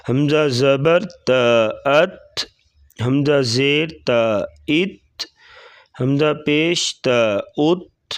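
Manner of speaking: slow, measured spelling-out of each letter; qaida drill recitation. Shown as a demonstration. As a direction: chant the syllables as a teacher drilling children.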